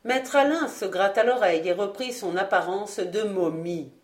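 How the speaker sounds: plain reading voice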